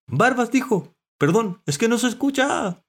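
high, loud dog shouting. A narrator's reading. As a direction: call out as if unheard. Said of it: "respondió el perro alzando la voz".

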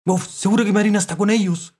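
high, loud dog voice; excited dog. A narrator's reading. Dog guessing where the girl is, high and excited. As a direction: burst in eagerly. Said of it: "dijo Galleta".

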